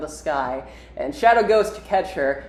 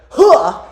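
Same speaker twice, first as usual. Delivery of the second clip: high, loud mock gasp of surprise; sharp put-on "huh"; startled exclamation; 9 semitones higher, 10 dB louder than usual. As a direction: imitate a character noticing someone. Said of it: "He's like".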